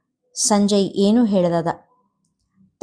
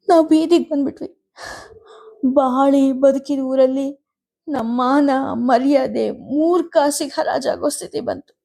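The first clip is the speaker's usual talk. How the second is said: grieving mother near tears; tearful lament; 5 semitones higher than usual. A narrator's reading. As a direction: wail over the family's lost honour. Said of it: "ಕಣ್ಣೀರು ಗರೆದರು".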